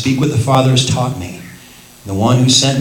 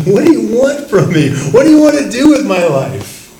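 higher, louder pleading questions; fervent plea; 7 semitones higher, 5 dB louder than usual. Acted. Believer praying earnestly to the Lord, higher and louder. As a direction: cry out to God for direction. Voiced prayer of a seeking believer.

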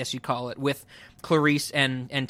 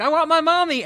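high-pitched imitation of a childlike plea; mock a traumatized captive wailing like a child; regressed child voice; 11 semitones higher, 4 dB louder than usual. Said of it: "she's reverting back to, like, she's in this traumatic experience".

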